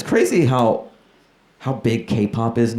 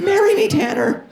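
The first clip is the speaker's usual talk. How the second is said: swooning fangirl. Retold or imitated. Screaming fan at concert.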